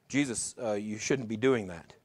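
low, soft, hesitant aside; hesitant objection. Retold or imitated. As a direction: imitate disciples timidly objecting under their breath. Voicing the disciples' awkward concern.

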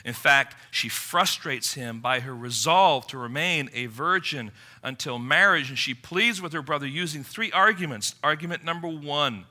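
usual speaking voice

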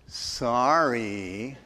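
low, drawn-out eye-roll apology; mock apology dripping with sarcasm; sarcastic. Shown as a demonstration. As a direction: stretch it out with heavy sarcasm, lifting at the end. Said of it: "you use sarcasm and you roll your eyes".

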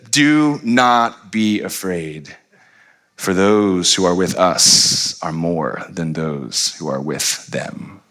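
very slow, steady prophet's reassurance; calm reassurance. Acted. Elisha calmly reassures his frightened servant, slow and steady. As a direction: settle him down slowly.

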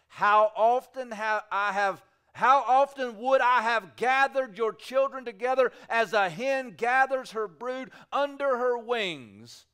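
loud, slowed, aching plea; loud lament. Acted. Preacher voicing Jesus to Jerusalem, loud slow lament. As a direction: cry it out like a longing call.

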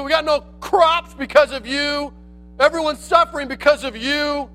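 indignant king blaming the troublemaker; angry accusation. Acted. Ahab angrily accuses Elijah.

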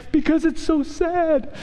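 a half-joking melancholy whine; mock mopey dad. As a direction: play up the gloomy father for laughs. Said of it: "I'm like".

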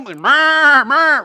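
loud, high mock animal bleat; imitating the noise of the animals; bleating livestock. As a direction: bleat like startled cattle. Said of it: "Imagine the noise".

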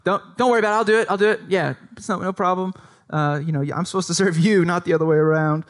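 high, hurried protest to the boss; a mock flustered employee, refusing help. Employee waving off the boss taking out his trash, flustered.

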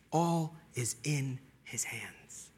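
hushed whisper of reassurance. Acted. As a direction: drop to a whisper, slow and tender. Whispered conclusion.

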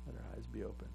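a hushed echoed plea; soft echo. Acted. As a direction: let "Let our eyes be open" fall away softly.

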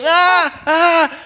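put-on high, loud childish wail "ah"; short mock toddler screams; imitated toddler screaming. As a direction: cry out high like a tantruming toddler. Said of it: "He was screaming".

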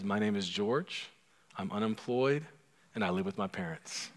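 soft, low acted admission; subdued confession. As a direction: confess it quietly after a steadying breath. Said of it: "He takes a deep breath and says".

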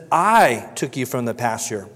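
"I" drawn out slowly and loud; stress on I. Acted. God reminding David of all he's done, stress on "I". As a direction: lean hard on the word "I". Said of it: "repeated emphasis on the word I".

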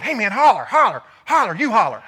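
loud, insistent pushing; mock bickering. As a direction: urge the other to yell. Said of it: "I'm like going".

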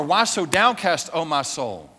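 loud call to your downcast soul; war cry to the soul. Shown as a demonstration. Pastor urging the church to cry out loudly. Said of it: "Go to war".

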